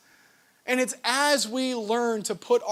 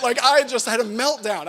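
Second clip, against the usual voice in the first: ordinary talk, nothing marked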